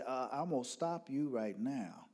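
soft-spoken Southern father; polite Southern lilt. Retold or imitated. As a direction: answer politely with a lilting Southern accent. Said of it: "southern voice and the lilt".

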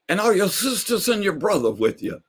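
high question; old neighbor asking. Retold or imitated. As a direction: ask it brightly. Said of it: "and said".

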